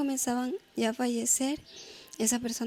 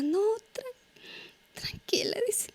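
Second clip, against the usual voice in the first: words cracking as the tears come; real crying; 9 semitones higher, 6 dB louder than usual. Real, not acted. Survivor breaking down crying while recalling the rubble. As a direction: voice breaking into sobs, pitched up.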